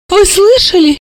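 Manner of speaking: high-pitched amazed question; astounded. A narrator's reading. Mother appealing to the guest, astounded. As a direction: exclaim it in disbelief, pitched high. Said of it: "поразилась Альбина Яновна".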